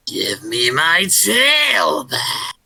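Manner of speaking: slow, drawn-out howl from the dark woods; spooky monster shout. Acted. Storyteller calling as the creature in the woods, slow howl. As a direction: stretch every word like a beast calling. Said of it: "That voice is really cool".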